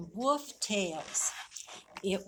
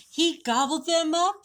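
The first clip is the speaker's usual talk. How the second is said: high, louder horrified cry; horrified shriek; 8 semitones higher, 6 dB louder than usual. A narrator's reading. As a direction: shriek in horror. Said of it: "shrieked the rabbits".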